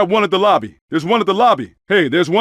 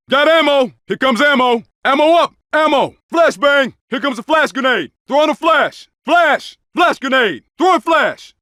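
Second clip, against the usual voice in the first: high shouted callouts to the squad; combat callouts; 7 semitones higher than usual. Acted. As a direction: yell it across the battlefield. Game soldier shouting combat callouts.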